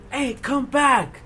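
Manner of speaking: high, drawn-out yell in imitation; retold scream. Staffer calling back escaping students, mock scream. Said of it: "she screams".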